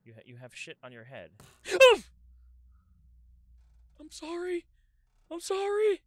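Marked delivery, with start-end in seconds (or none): 1.3-3.9 sharp, high gasp of fright; shocked loud gasp. Real, not acted. Startled by corpse moving. 4.0-4.7 high, loud frightened "sorry"; panicked apology. Real, not acted. Panicked apology to moving corpse. 5.3-6.0 "sorry" yelled high and loud in fright; panicked shout. Real, not acted. Panicked apology to moving corpse.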